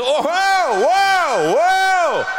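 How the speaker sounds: high, loud, drawn-out Italian wows; gushing wow. Retold or imitated. Italian stranger gawking at me, loud high wows. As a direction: gawk at her in high delight.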